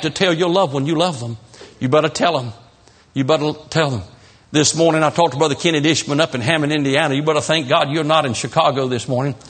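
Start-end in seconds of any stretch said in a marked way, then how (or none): none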